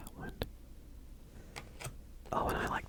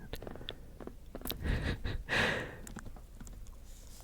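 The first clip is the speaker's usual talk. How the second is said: whispered laugh; 4 dB quieter than usual. Real, not acted. Laughs at nearly burning deck.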